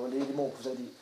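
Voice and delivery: quietly pin him down on "mots"; quiet insistence. Retold or imitated. CEO questioning the actor, quietly pressing on "mots". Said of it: "qu'est-ce que vous avez dit exactement".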